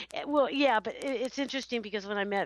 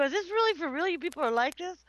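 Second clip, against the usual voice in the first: higher, incredulous questioning; retold disbelief; 7 semitones higher than usual. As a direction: act out being amazed at these people. Retelling her disbelief at snobs.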